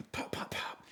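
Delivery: breathy pop pop pop, like distant shots; whispered gunshot sounds. Retold or imitated. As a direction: mimic far-off gunfire under your breath. Imitating gunshots heard down hill.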